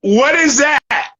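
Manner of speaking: can't believe what he's seeing; incredulous. Real, not acted. To his cohost about dudes in Crocs, loud and incredulous. Outraged by Crocs in hip hop.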